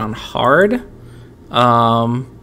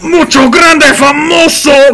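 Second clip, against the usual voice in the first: booming, slow, high announcer shout; monster truck ad; 12 semitones higher, 8 dB louder, slower than usual. Retold or imitated. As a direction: yell it like a stadium hype man. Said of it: "Spanish language monster truck commercials".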